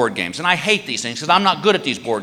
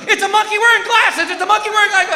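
high, laughing eager guess; excited guess; 14 semitones higher, 3 dB louder than usual. Retold or imitated. Wife excitedly guessing the drawing. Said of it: "She goes".

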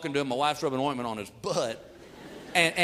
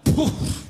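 sharp unvoiced puff of shock; stunned exhale; 10 semitones higher, 4 dB louder than usual. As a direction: blow out air, caught off guard. Dad stunned by question.

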